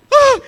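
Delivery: very high, loud yelp; startled scream. Retold or imitated. Preacher reenacting his fright at a slap, screaming. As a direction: yelp as if slapped from behind. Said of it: "me asustó".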